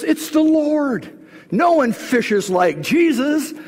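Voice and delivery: high, drawn-out exclamation; acted amazement, stretched out and pitched high; excited recognition. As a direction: call it out like a thrilled disciple. Acting Peter recognising Jesus from boat.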